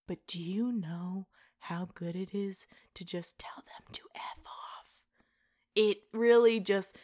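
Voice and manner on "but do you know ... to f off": mock whisper. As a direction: whisper the punchline like a naughty secret. Quoting a meme's mean punchline.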